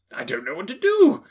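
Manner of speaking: half laugh, half sob; laughing and crying. A narrator's reading. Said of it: "cried Scrooge, laughing and crying".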